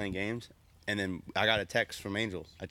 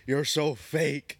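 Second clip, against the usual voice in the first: drawn-out, higher mock accusation; imitated anger; 4 semitones higher, 4 dB louder, much slower than usual. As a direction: slowly mimic mad friends accusing. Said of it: "they get all mad".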